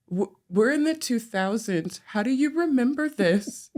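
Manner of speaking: retold disbelief, pitch climbing high; astonished question acted out from memory; incredulous. Asking Ramona how she remembers a childhood address, incredulous.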